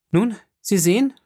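high-pitched, pressing challenge; firm, brusque. Acted. Offended viscount confronts the journalist, firm and brusque. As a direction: press him firmly, almost curtly. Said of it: "sagte Albert fest, ja schroff".

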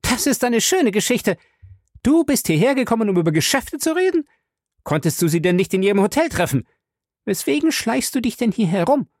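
hohe, schnelle, keifende Frauenstimme; wütend. A narrator's reading. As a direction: stellt den Ehemann scharf zur Rede. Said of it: "sah ihren Mann wütend an". (wütende Ehefrau, hoch und schnell)

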